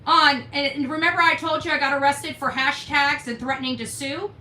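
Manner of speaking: ordinary delivery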